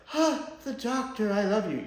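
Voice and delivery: a half-hearted parody of a swooning companion; mock lovesick Yaz. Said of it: "You know, the".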